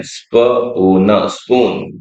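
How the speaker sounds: स्पून drawn out very slowly; stretched word. Shown as a demonstration. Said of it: "एस पी ओ ओ एन". (स्पून को लंबा खींचकर)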